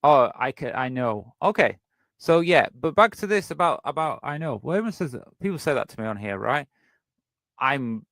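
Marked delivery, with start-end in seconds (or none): none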